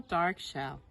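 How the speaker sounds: ordinary delivery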